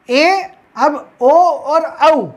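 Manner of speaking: slow vowel listing; drawn-out vowels. Shown as a demonstration. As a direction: stretch the vowels slowly. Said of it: "ये दोनों कहाँ से मिलेंगे".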